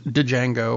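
"Django" with the D voiced as its own syllable, duh-JANG-go; D pronounced. Shown as a demonstration. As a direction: sound out the D at the start of "Django". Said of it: "I'm pronouncing it".

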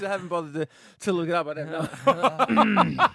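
words breaking into laughter; laughing. Real, not acted. Host admitting he never looked it up, laughing. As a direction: answer while cracking up, last words drawn out. Host cracking up, can't explain.

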